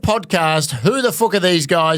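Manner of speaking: slow, announcer-style show opener; podcast intro. Acted. Host opening the podcast for listeners, slow announcer read.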